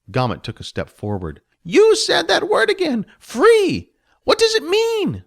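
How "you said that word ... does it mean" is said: high, loud goblin voice puzzling over a word; a goblin hearing a strange new word; curious goblin. Acted. Gomet asks Jess what free means.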